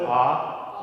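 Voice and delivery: loud, open "ah"; teacher's model "ah" vowel; ah of father. Shown as a demonstration. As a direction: sustain "ah" as in father. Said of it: "the ah of father".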